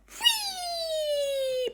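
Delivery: very high gleeful ghost cry; ghost whee. Acted. Ghosts bursting out of the treasure box, high glee. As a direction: squeal like a playful ghost.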